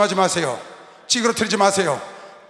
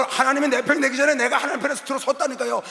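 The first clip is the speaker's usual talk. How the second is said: usual speaking voice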